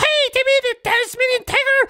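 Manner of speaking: very high, excited bird chatter; jovial kookaburra. Acted. Cookie answers his friend in a squawking bird voice.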